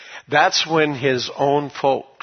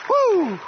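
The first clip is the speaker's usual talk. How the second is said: high praise whoop; exultant whoop. Real, not acted. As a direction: let out a high, joyful woo. Preacher whoops between praises.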